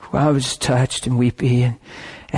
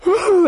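imitated crying whoop; 4 dB louder than usual. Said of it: "this lady went".

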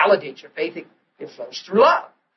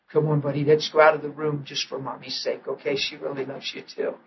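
gentle to son; 3 semitones lower, 7 dB quieter than usual. Retold or imitated. Father gently leads sick son.

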